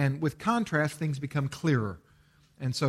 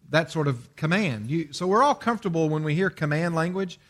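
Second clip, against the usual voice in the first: usual speaking voice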